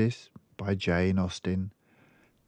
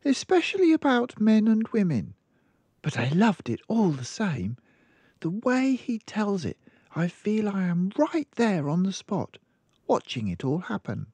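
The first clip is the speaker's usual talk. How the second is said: put-on much higher little girl voice; child explaining her mother's habits; small girl; 13 semitones higher than usual. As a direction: speak like a matter-of-fact little girl. Girl enthuses.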